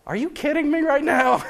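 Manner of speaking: high mock-incredulous question. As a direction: voice his disbelief at the tiny ketchup. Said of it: "he looks at me like".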